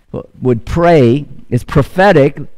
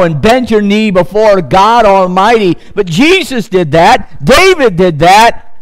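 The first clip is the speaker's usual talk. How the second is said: rising pulpit preaching, higher and building; 5 semitones higher than usual. Real, not acted. Pastor urging the congregation to come to the altar, fervent preaching. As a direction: urge the whole church toward the altar.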